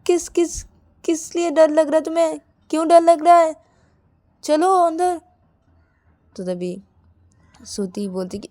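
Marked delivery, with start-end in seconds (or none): 0.1-5.2 high, exasperated questioning; impatient demand. A narrator's reading. Bride questioning frightened partner outside room, impatient. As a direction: press for an answer, then order inside.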